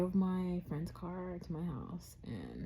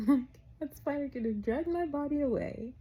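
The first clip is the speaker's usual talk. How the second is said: laughing exaggeration; 9 semitones higher, 6 dB louder than usual. Joking about spider danger.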